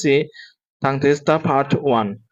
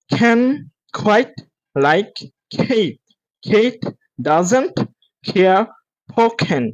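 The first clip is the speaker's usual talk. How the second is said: higher, word-by-word delivery; slow practice; 6 semitones higher, much slower than usual. Shown as a demonstration. Teacher models the sentence for students, slowly. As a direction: pause after every single word. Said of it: "দ্রুত বলার চেষ্টা করবেন".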